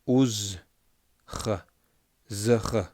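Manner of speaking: Uz, ch, z, ch, each sound said alone; isolated z and throaty ch; sounds split apart. Shown as a demonstration. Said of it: "Be careful with that".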